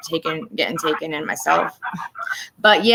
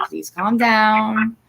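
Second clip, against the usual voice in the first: very slow, raised pleading; drawn-out plea; 4 semitones higher, much slower than usual. Retold or imitated. Woman urging Matthew to settle in court, drawn-out plea. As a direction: stretch each word, imploring him to settle.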